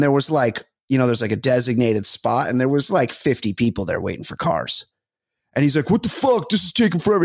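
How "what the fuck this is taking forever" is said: imitating a drunk friend's griping, pitched higher; mock-irritated complaint about the wait; pissed off. Drunk friend complaining about the long Uber queue, irritated. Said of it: "He's getting all pissed".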